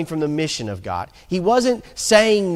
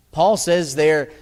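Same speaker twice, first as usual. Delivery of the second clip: ordinary talk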